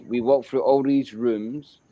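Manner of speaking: plain reading voice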